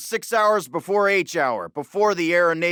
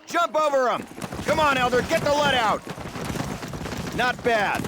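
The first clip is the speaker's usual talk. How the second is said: high barked commands; drill sergeant yelling; 7 semitones higher than usual. Acted. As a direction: bark each order across the field. Drill instructor yelling on course.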